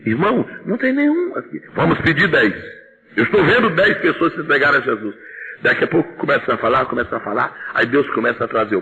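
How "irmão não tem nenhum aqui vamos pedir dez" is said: own retold voice. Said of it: "E eu disse assim".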